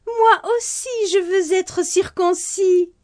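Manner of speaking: put-on high, eager little boy's voice; a child begging to join in. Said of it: "Sami s'enthousiasma".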